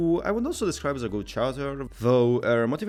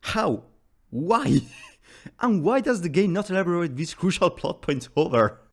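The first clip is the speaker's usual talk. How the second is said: loud, high-pitched mock despair; worked-up, hammed-up plea for answers; exasperated outburst; 6 semitones higher, 6 dB louder, slower than usual. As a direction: demand answers in comic frustration, voice raised. Mock rant about unanswered questions.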